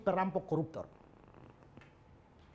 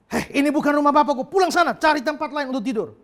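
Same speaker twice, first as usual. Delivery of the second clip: loud, raised scolding voice; angry shout; 8 semitones higher, 13 dB louder than usual. Retold or imitated. Pastor ordering the drunk to leave, angry shout. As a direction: shout him away from the church, louder and higher.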